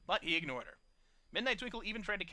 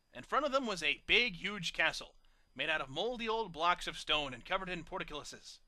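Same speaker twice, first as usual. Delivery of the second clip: plain reading voice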